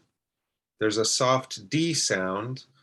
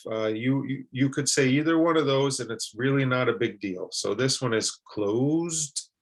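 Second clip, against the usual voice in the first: normal talking voice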